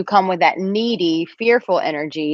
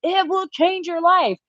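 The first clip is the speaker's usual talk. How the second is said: much higher, pushy mock enthusiasm; insistent gushing; 13 semitones higher than usual. Host imitating herself insisting to her annoyed dad, gushing. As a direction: insist like a zealot, half-joking. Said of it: "I'm like".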